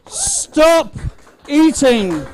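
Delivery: countdown building to shout. Real, not acted. Live countdown calling time on contest.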